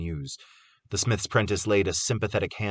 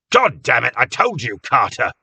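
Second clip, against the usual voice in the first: high, loud scolding of a friend; frightened anger; 4 semitones higher, 9 dB louder than usual. A narrator's reading. Cobb scolding Carter in frightened anger. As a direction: bark it out, scared and furious. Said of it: "frightened anger".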